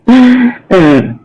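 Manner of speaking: very high laughing look; laughing squeal. Real, not acted.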